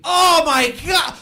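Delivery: hammed-up agonized joy, screamed; very high, loud congratulations as if tortured; Jerry. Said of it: "they sound like they're in pain also".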